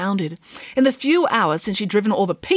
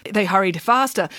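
plain reading voice

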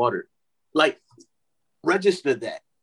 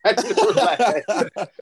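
laughing; 8 semitones higher, much slower than usual. Real, not acted. Laughing at his own rant.

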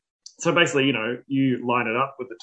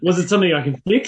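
pleading; 4 semitones higher, 6 dB louder, faster than usual. Retold or imitated. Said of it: "imitating anxious inner voice".

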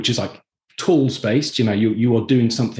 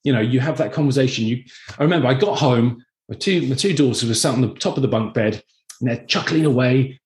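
normal talking voice

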